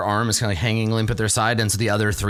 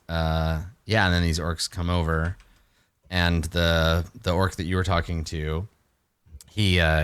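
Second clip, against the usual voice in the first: usual speaking voice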